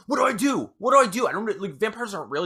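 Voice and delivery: high, panicky voice; acted frantic bewilderment; freaking out. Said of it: "freaking out".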